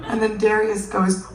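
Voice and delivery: ordinary talk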